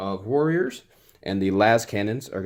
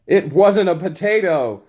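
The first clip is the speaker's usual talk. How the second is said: higher, louder mocking echo; 8 semitones higher, 7 dB louder than usual. Player loudly echoing the opponent's remark. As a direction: parrot the line back teasingly. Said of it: "repeating his opponent".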